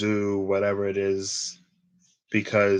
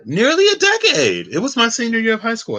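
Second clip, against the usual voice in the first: incredulous; 13 semitones higher, 8 dB louder than usual. Real, not acted. Amazed at years passed.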